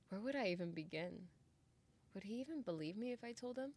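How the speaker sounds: ordinary talk, nothing marked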